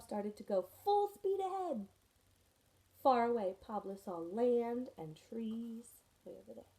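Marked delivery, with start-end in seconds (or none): none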